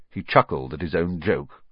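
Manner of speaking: usual speaking voice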